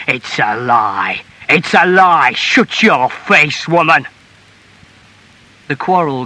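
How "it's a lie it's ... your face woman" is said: growing from slow denial to loud shout; enraged husband, building; furious denial. A narrator's reading. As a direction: shout her down angrily. Husband cuts in, quarrel fiercer.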